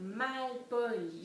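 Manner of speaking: MALPOLI slowly split into three beats; découpé en syllabes. Shown as a demonstration. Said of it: "J'ai trois syllabes". (« malpoli » lentement, en trois syllabes)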